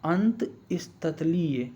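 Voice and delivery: अंतस्थलीय very slow, "ant... is-ta-thaliye"; sounding it out. Shown as a demonstration. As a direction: draw out अंतस्थलीय part by part. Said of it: "क्या होगा?".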